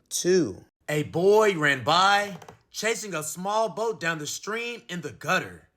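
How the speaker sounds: ordinary delivery, nothing marked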